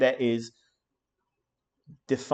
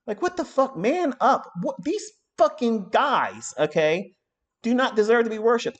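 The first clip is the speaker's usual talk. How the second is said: much higher, louder exasperated yell; indignant outburst; 9 semitones higher, 3 dB louder than usual. Real, not acted. Scolding fans who worship gurus, outraged. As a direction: rage at the fawning fans. Angry rant at fawning followers.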